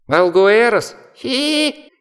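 very high squealing bat giggle; shrill bat squeal. Acted. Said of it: "засверестел нетопырь".

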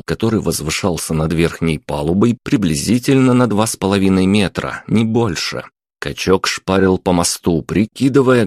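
ordinary delivery, nothing marked

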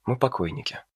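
soft, submissive repeat; obedient quiet echo. A narrator's reading. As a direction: echo him meekly. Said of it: "послушно откликнулась Джулия".

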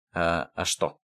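low, stumbling puzzled question. A narrator's reading. Ders answering about his gas mask, puzzled. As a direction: ask it low, not understanding. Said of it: "не понял Дарс".

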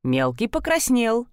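usual speaking voice

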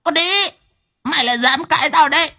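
high, fast screeching crone; glaring hag. Acted. Grandmother glaring at her daughter-in-law, screeching. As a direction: snap it furiously. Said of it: "trợn mắt".

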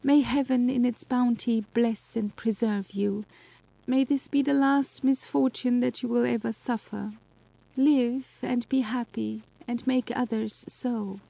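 bravely calm, tears just under the words; half-suppressed emotion. Acted. Justine bids dearest Elizabeth farewell, tears held back. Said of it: "said in a voice of half-suppressed emotion".

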